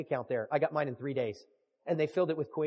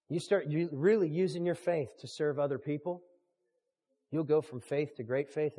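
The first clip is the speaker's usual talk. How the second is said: ordinary talk, nothing marked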